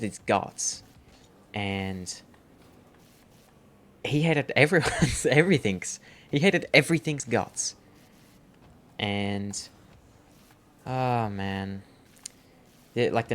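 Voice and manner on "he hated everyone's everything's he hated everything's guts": louder and higher, laughing indignation; laughing rant. Real, not acted. Telling friends how the chicken hated everything, laughing loudly. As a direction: pile it on, cracking up.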